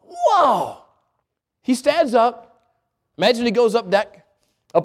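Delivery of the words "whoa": awed whoa. Real, not acted. Awe at calmed storm.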